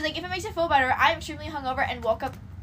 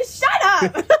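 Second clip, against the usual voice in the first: loud, high laughing shout; mock-offended, cracking up; playful protest; 6 semitones higher, 8 dB louder than usual. Real, not acted. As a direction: yell it back while laughing. Teased about her looks.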